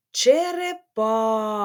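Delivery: ЧЕРЕПАХА slowly with a long stressed А in ПА. Shown as a demonstration. Teacher saying the word for pupils, ПА drawn out. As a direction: stretch the third syllable of ЧЕРЕПАХА. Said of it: "Який голосний я вимовляю".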